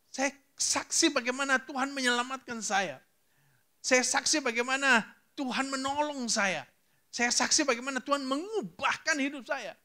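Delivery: high-pitched, fervent preacher's testimony; full-force pulpit delivery; impassioned preaching. Real, not acted. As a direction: proclaim it with mounting fervour, voice lifted high.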